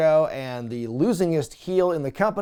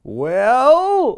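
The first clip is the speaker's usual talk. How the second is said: hesitant well; 9 semitones higher, 8 dB louder than usual. Retold or imitated. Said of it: "Sharpton just goes over to Chris and goes".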